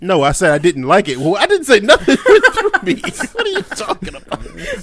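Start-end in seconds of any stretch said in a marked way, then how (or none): 1.2-4.8 high-pitched denial breaking into laughter; laughing denial. Real, not acted. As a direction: object while helplessly laughing.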